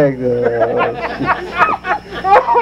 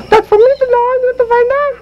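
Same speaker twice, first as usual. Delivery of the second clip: very high squeaky dummy voice; high puppet voice; Pee Wee dummy voice; faster than usual. Acted. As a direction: pitch way up for the puppet. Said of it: "here's my ventriloquist act".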